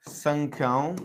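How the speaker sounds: one word at a time, very long pauses; slow dictation. Real, not acted. Teacher writing the Hindi term out for a student, slow dictation. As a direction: spell it out slowly as you write.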